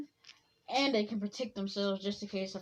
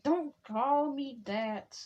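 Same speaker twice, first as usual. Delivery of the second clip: annoyed warning; 5 semitones higher, slower than usual. Acted. Tsunade annoyed at nickname.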